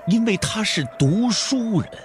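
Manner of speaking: usual speaking voice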